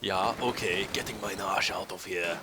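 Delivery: put-on a light, low, slow hammy German accent. Mocking the game's voice acting, lightly hammy German accent. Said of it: "it's the hammiest German accent".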